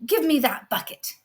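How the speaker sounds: loud, demanding sister; greedy brat grabbing for the bucket; loud whiny demand; jealous brat. Acted. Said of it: "the not so nice daughter goes".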